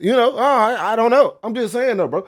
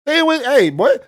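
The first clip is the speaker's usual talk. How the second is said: usual speaking voice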